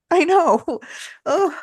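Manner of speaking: high laughing groan. Real, not acted. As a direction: laugh through the words. Laughing at grim story detail.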